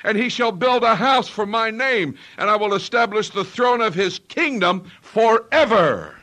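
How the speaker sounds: loud, emphatic bible verse; preacher booming out Second Samuel; thundering scripture reading. Acted. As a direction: read it like a proclamation. Reading scripture at full force.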